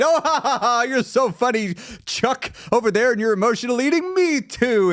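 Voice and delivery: high, fake-laughing group banter; mock chummy laughter. Friends joking about emotional eating, mock chummy laughter. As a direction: gush along with a fake ha-ha-ha.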